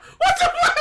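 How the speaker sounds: very high squeal of retold disbelief through laughter; helpless laughter. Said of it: "I'm laughing like I am now".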